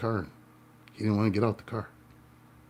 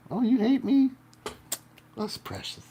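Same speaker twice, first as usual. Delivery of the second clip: much higher, sing-song sarcasm; mock delight; 11 semitones higher than usual. To an imagined hater, a sarcastic mock-delighted coo. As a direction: pretend to be charmed.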